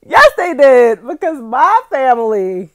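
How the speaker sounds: loud, slow and high; loud emphatic. Real, not acted. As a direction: belt it out proudly. Hot sauce at the funeral.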